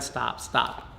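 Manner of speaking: tense hush. Retold or imitated. Father urging daughter to stop.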